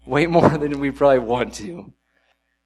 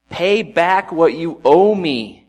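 louder, higher and slow, every word a threat; loud slow demand; 5 semitones higher, 8 dB louder, slower than usual. A narrator's reading. Servant choking his fellow, loudly demanding repayment. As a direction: demand the money back, hard and slow. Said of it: "he demanded".

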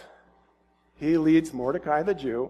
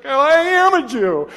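loud, high, hammed-up protest; acted outraged comeback; indignant retort; 8 semitones higher, 8 dB louder than usual. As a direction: fire it back indignantly. Esther retorts to Haman.

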